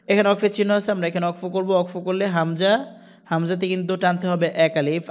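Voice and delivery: ordinary delivery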